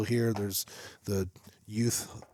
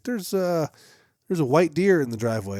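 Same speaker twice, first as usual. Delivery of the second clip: raised pitch, quoting her news; imitating partner's announcement; 6 semitones higher, 5 dB louder than usual. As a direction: voice her startled report from the window. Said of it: "and she's like".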